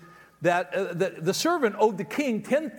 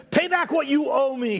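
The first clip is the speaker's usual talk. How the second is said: louder, higher, harsh demanding voice; angry demand; 5 semitones higher, 4 dB louder than usual. Acted. Unforgiving servant chokes his fellow servant, angrily demanding payment. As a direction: grab him by the collar and demand it. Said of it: "he demanded".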